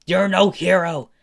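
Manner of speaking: high, loud denunciation; angry shout. Acted. Izuku denouncing Endeavor for hurting his daughter, shouting angrily. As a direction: hurl the accusation at him.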